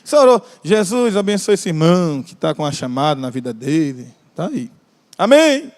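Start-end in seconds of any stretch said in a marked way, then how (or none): none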